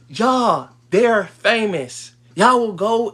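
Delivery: drawn-out exasperated "they're famous"; exasperated emphasis. Real, not acted. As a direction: spell it out, exasperated. Exasperated point to viewers.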